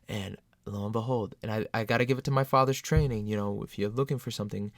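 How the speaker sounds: ordinary talk, nothing marked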